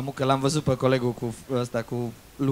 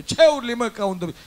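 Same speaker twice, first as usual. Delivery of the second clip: loud, higher annoyed retort; annoyed boss; 7 semitones higher, 8 dB louder than usual. Acted. Boss snaps at the barman for yelling. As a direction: bark back at the one shouting.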